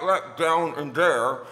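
garbled words round an object; slow, muffled mouth-propped speech; mouth full. Shown as a demonstration. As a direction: talk with something stuck in the mouth. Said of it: "He sticks this thing into my mouth".

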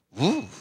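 short, loud "woo" of wonder; amazed whoosh. Retold or imitated. Preacher acting out the chief at the elevator, amazed whoosh. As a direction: let out a sudden breathy exclamation of amazement.

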